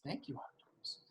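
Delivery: very soft, tiny thank-you; barely audible polite thanks; meek thanks. A narrator's reading. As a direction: say it small and hushed. Boy's meek thanks to octopus.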